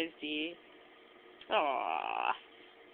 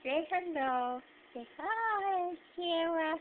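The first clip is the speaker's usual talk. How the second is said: high sing-song pet voice; 8 semitones higher, slower than usual. Real, not acted. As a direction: coo at the kitten. Baby talk to the cat.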